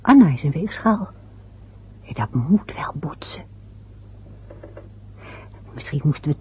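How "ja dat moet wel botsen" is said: hushed whispered aside to herself. Acted. Wife whispers her aside about the clashing signs. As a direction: drop to a whisper, half to herself.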